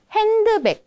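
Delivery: handbag slow, as hen-de-bek; hen-de-bek pelan; Korean handbag. Shown as a demonstration. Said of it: "kita baca hand".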